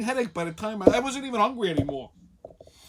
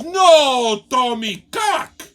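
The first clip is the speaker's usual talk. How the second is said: put-on Spanish accent; 3 semitones higher, 10 dB louder, much slower than usual. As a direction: cry it out as the bartender, Spanish accent. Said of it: "her English is so bad".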